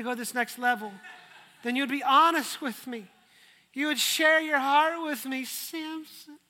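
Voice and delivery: guilt-trip him into telling; hammed-up nagging Delilah. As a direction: Delilah pressuring Samson for his secret.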